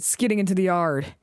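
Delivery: usual speaking voice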